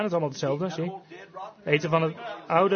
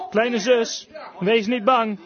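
brother calling to his sister, raised and louder; 4 semitones higher, 4 dB louder, much slower than usual. Retold or imitated. Brother calling to his scared little sister in the dark, calling out. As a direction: call out reassurance, pitched up. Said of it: "haar broertje riep haar toe".

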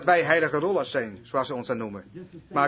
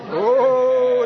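high, loud, drawn-out "oh"; imitated cry; 6 semitones higher, 7 dB louder than usual. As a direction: cry out long and high like the overcome boy. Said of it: "riep hij toen". (nagedane langgerekte, hoge kreet)

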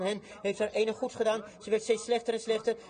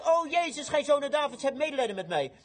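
acted desperate cry; 8 semitones higher than usual. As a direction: cry out for mercy over a crowd. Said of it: "Toen begon hij te schreeuwen".

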